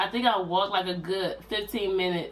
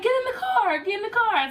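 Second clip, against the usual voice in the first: much higher, pushy repeated command; high chirpy get-in-the-car order; mock bossy sister voice; insistent sister; 14 semitones higher than usual.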